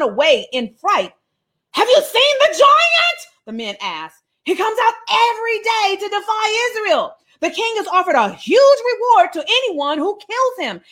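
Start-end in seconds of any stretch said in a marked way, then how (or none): 1.7-3.2 high, drawn-out cry of alarm; high-pitched, acted-out soldiers' panic. 4.4-10.8 high, animated soldier's report; worked-up, dramatic storytelling voice; agitated report. Acted. Soldiers' alarmed report acted out.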